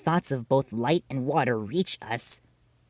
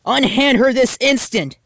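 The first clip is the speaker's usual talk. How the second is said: much higher, louder commanding cry; mock-heroic full-volume command; heroic shout; 13 semitones higher, 10 dB louder than usual. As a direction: shout to rescue your friend. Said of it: "shouted Rika".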